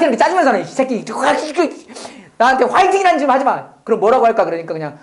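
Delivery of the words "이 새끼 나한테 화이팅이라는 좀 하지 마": irritated retort. Retold or imitated. Depressed counselee snapping at cheerleading.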